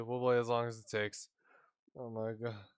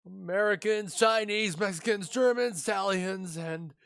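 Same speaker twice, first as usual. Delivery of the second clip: higher and louder, rattling off a grand list; boastful excited chef; 6 semitones higher, 4 dB louder than usual. Acted. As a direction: show off the supermarket like a showman. Voices boastful chef listing foods.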